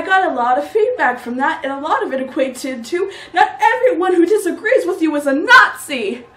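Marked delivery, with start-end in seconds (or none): 3.3-6.3 high, loud mock protest; indignant objection. Comedian quotes feedback on their routine, loud and indignant. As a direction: object loudly, pitched up in indignation.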